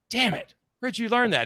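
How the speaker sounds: a hit of surprise, caught out. Retold or imitated. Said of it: "I was like".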